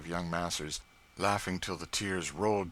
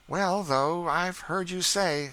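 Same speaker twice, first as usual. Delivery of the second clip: put-on teasing boy; 12 semitones higher, 7 dB louder, slower than usual. A narrator's reading. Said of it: "said George".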